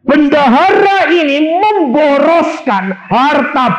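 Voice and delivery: high, loud, slow pulpit proclamation; thundering high pulpit shout; preaching shout. Real, not acted. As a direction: proclaim it at full force.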